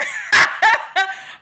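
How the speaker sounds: high, loud burst of laughter. Real, not acted. Reacting to the men's messages, loud high cackle. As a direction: crack up loudly.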